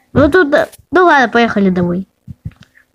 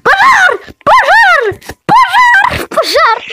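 alarm shout; slower than usual. Real, not acted. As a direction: scream the alarm at full voice.

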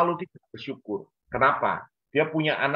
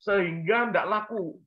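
higher, lightly voiced sulky child; child's voice; 9 semitones higher than usual. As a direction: whine it up high like a disappointed child. Child voiced explaining bad sales. (anak mengeluh dengan suara tinggi)

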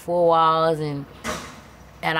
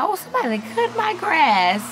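much higher, louder exclamation of surprise; mother finding the grass already cut, surprised; surprised delight; 13 semitones higher, 4 dB louder than usual. Retold or imitated. Mother surprised by mowed lawn.

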